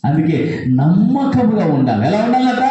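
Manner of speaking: loud, high, driving sermon cadence; fiery preaching. Acted. Preacher rousing the congregation about being used, fiery preaching. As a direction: preach it out to the back rows.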